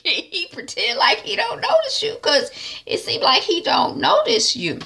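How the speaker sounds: high, squeaky words through giggles; laughing mockery. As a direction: tease her while cracking up. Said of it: "T Y leaves laughing".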